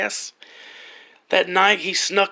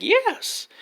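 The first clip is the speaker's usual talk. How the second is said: put-on old lady voice.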